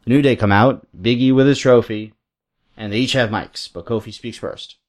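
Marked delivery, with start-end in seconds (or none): none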